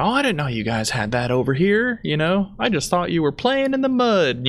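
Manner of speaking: lightly voiced ignorant tourist, rising high; mocking tourist. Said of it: "she was very loud. She was very ignorant".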